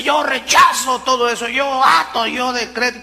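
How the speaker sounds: hammed-up claim of rebuking everything; mocking loud declaration. Preacher mimics showy faith talk with a loud mock decree. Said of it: "No la fe que dice".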